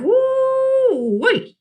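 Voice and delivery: high, drawn-out whoo-wee; delighted whoop. A narrator's reading. Gloria marvels at Otis.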